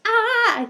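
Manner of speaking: high, loud excited squeal; toddler squeal. Retold or imitated. Aunt mimicking her excited two-year-old nephew, high squeal. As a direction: mimic a thrilled toddler's shriek.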